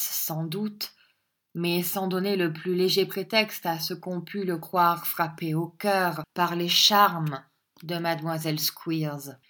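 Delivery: ordinary delivery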